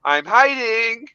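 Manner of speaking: put-on high, slow sing-song child voice; playful toddler calling out from a hiding spot; little kid hiding. Father imitating his toddler hiding in the nativity scene, sing-song child voice. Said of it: "all of a sudden you hear".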